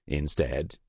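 ordinary delivery

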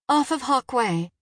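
high, tense, hurried-sounding answer; worried mother on an emergency call; urgent reply. A narrator's reading. Frantic mother gives dispatcher address.

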